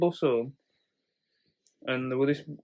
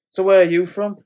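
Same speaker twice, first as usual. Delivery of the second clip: mock drunk woman; 6 semitones higher, 9 dB louder than usual. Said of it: "and then she's like".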